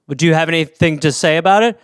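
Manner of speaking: normal talking voice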